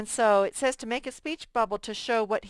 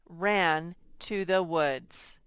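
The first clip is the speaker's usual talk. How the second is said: slow reading; much slower than usual. Shown as a demonstration. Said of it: "teacher reads example slowly".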